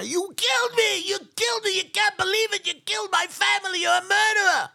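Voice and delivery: high, loud anguished wail; crying, screamed accusation. Retold or imitated. Survivor facing the man who killed his family, crying scream. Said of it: "Mister Friedman started to cry".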